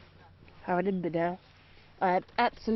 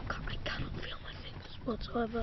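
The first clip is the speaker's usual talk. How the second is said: hushed whisper, barely voiced; 8 dB quieter than usual. Real, not acted. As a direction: whisper it, frozen and numb. Freezing girl, numb fingers.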